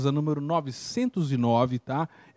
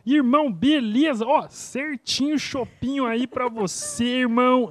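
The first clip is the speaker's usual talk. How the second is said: higher, slow buddy voice; imitating Fabião; 6 semitones higher, 4 dB louder, slower than usual. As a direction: drawl it like a laid-back friend. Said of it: "ele fala desse jeito".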